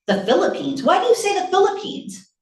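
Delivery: startled, high-pitched challenge; incredulous mother. Acted. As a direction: question the child in alarm, pitch climbing. Mother startled by son's answer.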